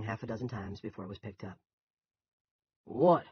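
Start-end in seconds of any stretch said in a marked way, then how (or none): 2.8-3.3 loud, irritated phone bark; sharp bark down the phone. A narrator's reading. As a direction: snap it out high and loud. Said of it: "the sire of the multitudinous Killian brood barked".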